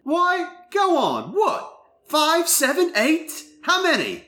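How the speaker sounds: put-on high-pitched British-accented Skylar; Minnie Driver accent. Skylar quizzing Will on his family, in a British accent. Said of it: "do it in the Minnie Driver accent".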